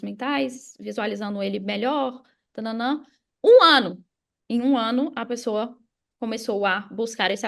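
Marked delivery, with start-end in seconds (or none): 3.4-4.0 "Um ano" punched much louder and higher; emphatic stress. Real, not acted. Telling the audience how long it took, "Um ano" punched loud. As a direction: hammer home "Um ano" to stress the length.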